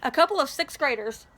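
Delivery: ordinary delivery, nothing marked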